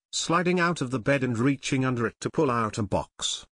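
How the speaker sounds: usual speaking voice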